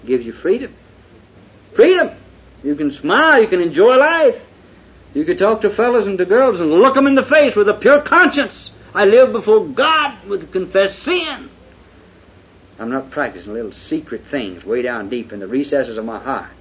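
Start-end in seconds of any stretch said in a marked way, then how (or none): 1.7-11.5 high, loud pulpit preaching; fiery preaching. Acted. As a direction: thunder it from the pulpit. Preaching at full force.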